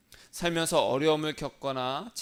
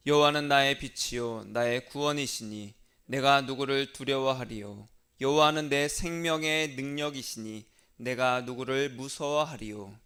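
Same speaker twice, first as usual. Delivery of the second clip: loud, measured psalm recitation; solemn liturgical reading voice; scripture reading; 5 dB louder than usual. Acted. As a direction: proclaim the psalm to the congregation.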